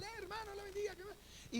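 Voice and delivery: high, soft sing-song greeting to a brother; lightly imitated sweet hello; mock church greeting. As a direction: chirp it up high like a sugary churchgoer. Pastor mimics a friendly church greeting.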